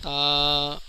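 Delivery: تا with a long, soft, level aa; drawn-out letter. Shown as a demonstration. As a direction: hold the vowel of تا steadily. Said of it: "کھینچ کے پڑھیں گے".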